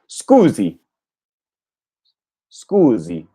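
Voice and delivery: scusi with a buzzing z; repeating Italian. Shown as a demonstration. Learner echoing the tape, Italian scusi. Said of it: "sound exactly like his".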